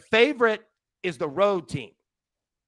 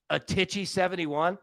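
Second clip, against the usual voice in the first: ordinary talk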